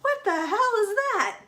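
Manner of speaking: high, loud cry of alarm; panicked outburst. A narrator's reading. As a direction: blurt it out in startled panic, fully voiced. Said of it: "breathed in a panic whisper".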